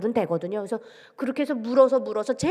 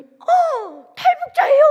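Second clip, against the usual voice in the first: very high, loud gasped question; astonished; 8 dB louder, much slower than usual. Retold or imitated. Server realizes she faces a North Korean defector, astonished high cry. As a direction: act out shocked recognition.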